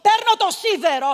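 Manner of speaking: ordinary talk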